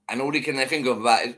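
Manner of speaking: ordinary talk, nothing marked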